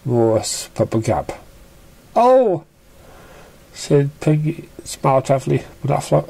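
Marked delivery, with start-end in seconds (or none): 2.2-2.7 high startled oh; a sudden high oh; surprised gasp. Acted. Character exclaims.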